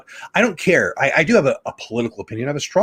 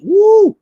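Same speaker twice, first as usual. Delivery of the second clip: very high, loud "woo"; excited whoop; 9 dB louder than usual. Real, not acted. Fan watching a fastball hit ninety-eight, excited high whoop. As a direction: whoop at the radar reading.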